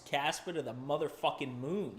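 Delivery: ordinary talk, nothing marked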